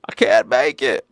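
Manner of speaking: put-on high, old man's quavering complaint; old man still working. Comedian imitating old working man, high creaky voice. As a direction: whine like a broke elderly worker. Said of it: "The one that are like".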